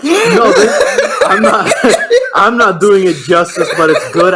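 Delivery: loud, high, words breaking up with laughter; laughing protest. Real, not acted. Defending his match recap to his teasing co-host, laughing. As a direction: defend yourself through a fit of laughter.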